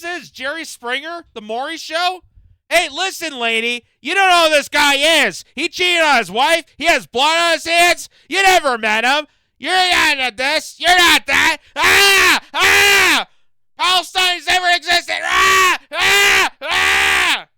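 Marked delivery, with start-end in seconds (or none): 2.7-9.3 loud, high yell mimicking the guest; mocking yell. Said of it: "you have to yell and scream at the top of your lungs". 9.6-11.6 loud, high yell mimicking the guest; mocking yell. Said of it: "you have to yell and scream at the top of your lungs". 11.8-17.5 loud, high mock screams of Ah mocking the guest; mock screaming. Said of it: "you have to yell and scream at the top of your lungs".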